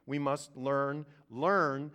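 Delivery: learn hit hard and loud, said twice; stress on learn. Shown as a demonstration. Preacher stressing learn to the congregation. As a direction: lean on the word learn. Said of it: "I'm stressing the word learn".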